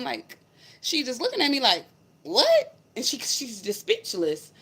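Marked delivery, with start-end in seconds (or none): 2.2-2.8 retold stunned "What?" pitched far higher and louder. Stranger at door to storyteller, stunned high what. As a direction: her jaw-drop, acted out high.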